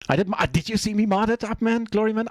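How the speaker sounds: high, bright boasting to chat; giddy showing-off; excited brag. Real, not acted.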